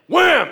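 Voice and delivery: shouted impact sound, high; loud wham. Retold or imitated. Paul striking the magician blind, shouted wham. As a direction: slam the word out like a blow.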